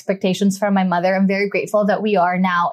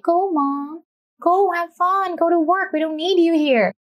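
family voice; 8 semitones higher than usual. Retold or imitated. Family cheering her off to work.

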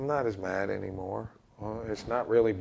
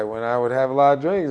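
ordinary talk

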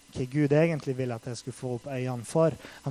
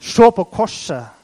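God's voice; 5 semitones higher, 12 dB louder, slower than usual. Retold or imitated. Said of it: "sa Gud".

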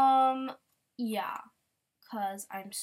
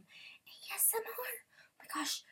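breathy, playful ASMR whisper; fake ASMR whisper; 12 semitones higher, 9 dB quieter, slower than usual. Shown as a demonstration. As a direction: whisper it like a mock ASMR video. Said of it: "a fake A S M R kind of thing".

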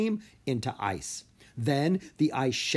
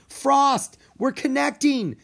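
high, loud and joyful; happy cry; 14 semitones higher, 7 dB louder than usual. A narrator's reading. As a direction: cry out with delight. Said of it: "she cried happily".

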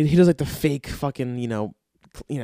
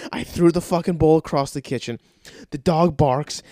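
usual speaking voice

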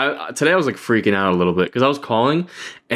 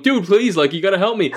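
retold screaming plea; 9 semitones higher than usual. Caller begs customer service agent for help, high pleading cry. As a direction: beg the agent for help at a higher pitch. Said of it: "I'm, like, screaming".

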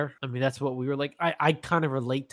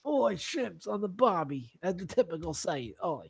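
mock Australian accent; 5 semitones higher than usual. Joking on a podcast about a surf show, hammed-up Australian accent. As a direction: lay on a cartoon Australian twang. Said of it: "my Australian accent".

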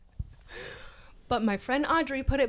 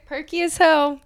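loud emphatic repeat; 6 semitones higher, 10 dB louder than usual. Acted. Repeats phrase for comic emphasis.

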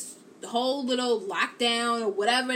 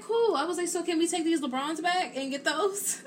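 high, bright eager asking; 8 semitones higher than usual. Retold or imitated. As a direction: push the ask with a laugh in it. Asking son to return shoes.

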